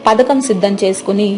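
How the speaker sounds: usual speaking voice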